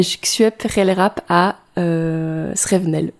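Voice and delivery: phrase said syllable by syllable in reverse, "je suis rap à Srevenel"; backwards speech. Shown as a demonstration. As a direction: reverse the sounds of "Srevenel", slow and careful. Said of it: "je peux parler à l'envers". (parole à l'envers, syllabes inversées)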